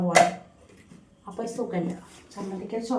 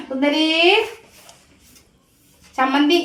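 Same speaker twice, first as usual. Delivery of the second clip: calling the cat; 7 semitones higher, 7 dB louder, slower than usual. Real, not acted.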